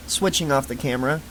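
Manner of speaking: ordinary delivery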